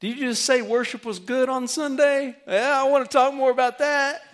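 a friend keen to talk about worship; excited friend. Retold or imitated. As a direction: jump in like a curious friend. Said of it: "your friend says".

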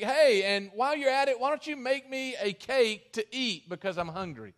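a half-yelled demand; mock yell. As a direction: call out to her, hammed-up but not a full shout. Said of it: "then he yells at her, so to speak".

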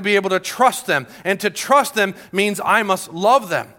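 impassioned preacher, stretching the key words; forceful preaching. Real, not acted. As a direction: drive the point home to the congregation.

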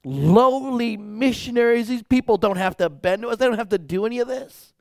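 mildly hammed-up amazement, high pitch; retold awe, high and loud at the start; awed. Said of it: "watching this in awe".

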